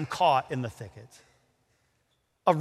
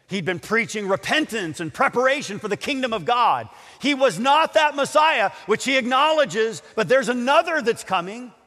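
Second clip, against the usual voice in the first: high, loud, driving sermon delivery; forceful preaching; 4 semitones higher, 4 dB louder than usual. Real, not acted. Preacher tells how John announced another was coming, forceful preaching.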